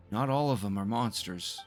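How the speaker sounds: ordinary talk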